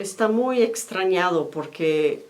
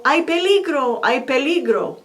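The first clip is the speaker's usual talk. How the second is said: higher, louder call of alarm; warning shout; 6 semitones higher, 4 dB louder than usual. Acted. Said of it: "deja claro si está diciendo".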